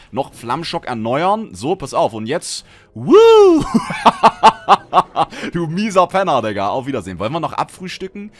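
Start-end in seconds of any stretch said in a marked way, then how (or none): 2.9-5.5 loud high cheer into laughter; triumphant whoop and laugh. Real, not acted. Gamer after a big kill, loud whooping laugh. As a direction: whoop in victory.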